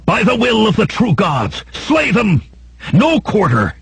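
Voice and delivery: high-pitched rallying war cry; battle cry. A narrator's reading. Jenar to his charging hillmen, fierce high battle cry. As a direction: cry out an attack order, voice pitched far up. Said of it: "Jenar's cry rent the darkness".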